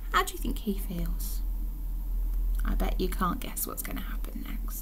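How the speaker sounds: soft, conspiratorial whisper; hushed aside. Real, not acted. Reader teasing children about what's next, whispered aside. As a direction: whisper it to the listeners.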